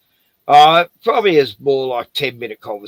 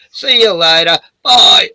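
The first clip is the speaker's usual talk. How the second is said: a sing-song, louder, higher bye to slam the door on; see him off loudly and mockingly; shooing the council officer away before slamming the door, loud and mocking; loud mock farewell; 5 semitones higher, 7 dB louder than usual.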